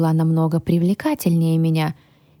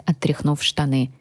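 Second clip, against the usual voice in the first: ordinary delivery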